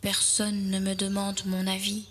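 low, soft, subdued thought; sad musing. Acted. Little fir feels nobody listens, sad and hushed. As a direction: think it quietly to yourself, sadly. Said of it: "songe-t-il tristement".

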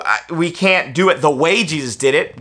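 ordinary delivery

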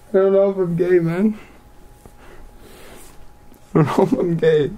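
ordinary talk

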